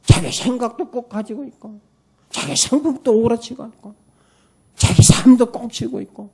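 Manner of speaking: high, put-on voice of someone clinging on; mocking caricature. Caricatures people clinging to themselves. (움켜쥔 사람을 비꼬듯 흉내 내는 높은 목소리)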